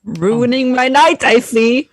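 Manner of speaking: high, loud irritated sigh; irritated, put-upon complaint; annoyed. Acted. Annoyed character remark.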